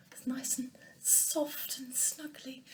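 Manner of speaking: soft, slow, unvoiced whisper; whispered snuggly. Sheep offering a bed to newcomers, whispered softly. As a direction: breathe it like a cosy secret.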